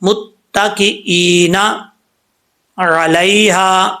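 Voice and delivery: متکئین علیہا drawn out slowly; slow recitation. Shown as a demonstration. Teacher answers how to read, slowly. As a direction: recite both words very measured. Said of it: "پڑھیں گے کیسے؟".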